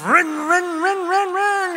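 high, loud mock engine sound; revving engine noise. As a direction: rev it like a dying car. Imitating car engine revving.

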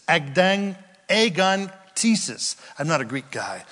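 ordinary talk

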